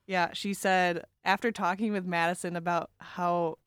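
ordinary delivery

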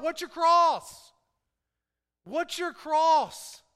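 emphatic, ringing pulpit delivery; preaching. Real, not acted. Preacher tells the church about the cross, emphatic preaching. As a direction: drive each point home to the congregation.

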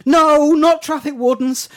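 loud, slow panicked no; panicked cry. Acted. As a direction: cry out in terror. Scared of traffic wardens.

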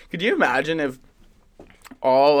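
normal talking voice

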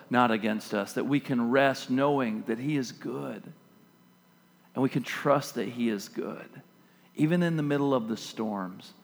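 soft, low, intimate sermon voice; hushed preaching. Acted. Pastor softly reassuring the congregation, hushed preaching. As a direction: draw them close and speak gently of peace.

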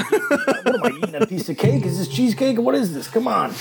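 high, laughing disbelief; puzzled laughing questions; surprised. Real, not acted. Surprised by unexpected food.

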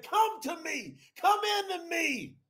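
high pleading call; crying out. Retold or imitated. Preacher reenacting his prayer to God, high pleading cry. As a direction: beg God to come in. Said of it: "I said".

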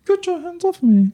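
put-on a posh British toddler telling someone off, overdone; mock British accent. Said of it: "maybe he'll have a British accent".

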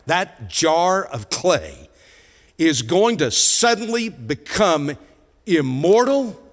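normal talking voice

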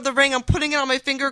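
usual speaking voice

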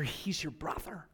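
voiceless, secretive hush; whispered. Retold or imitated. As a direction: murmur it to silence her. Hushed silencing of a victim.

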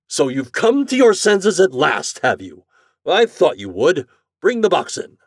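high, loud, self-satisfied greeting; triumphant customer. A narrator's reading. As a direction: crow at the man who finally gave in. Morehouse greets Flannery triumphantly.

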